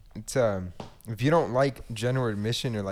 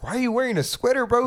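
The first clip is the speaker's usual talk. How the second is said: mock high voice; 13 semitones higher than usual. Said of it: "I was like".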